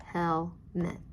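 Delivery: "Helmet" as hel, pause, met; tapped-out syllables. Shown as a demonstration. Teacher tapping out "Helmet" for pupils, syllable by syllable. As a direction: give each syllable of "Helmet" one beat.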